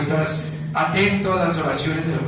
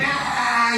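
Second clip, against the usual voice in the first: put-on child's tantrum wail; 8 semitones higher than usual.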